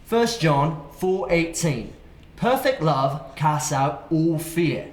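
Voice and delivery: slow, measured British accent; deliberate British scripture reading. Shown as a demonstration. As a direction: read it out in a put-on British voice. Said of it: "I'm gonna do a British accent".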